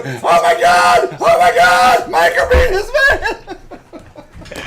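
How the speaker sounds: very high, loud laughing shriek; shrieking laughter. Real, not acted. Cracking up at his own story, shrieking. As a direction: squeal it out through laughter.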